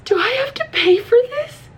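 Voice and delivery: high, squeaky tearful question; mock crying. Asking a fireman about paying, high mock crying. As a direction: whimper it in a put-on tearful squeal. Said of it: "I'm still crying a little bit".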